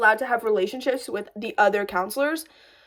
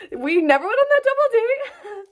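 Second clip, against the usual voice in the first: higher, giggling punchline; laughing; 10 semitones higher than usual. Real, not acted. Ending the story for viewers, laughing. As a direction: laugh through the line.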